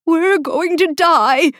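high, loud anxious cry; drawn-out wail of doom; panicked. A narrator's reading. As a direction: blurt it out in high-pitched terror. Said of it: "his leaves rustling so badly".

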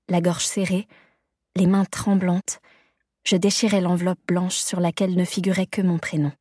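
usual speaking voice

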